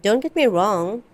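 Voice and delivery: usual speaking voice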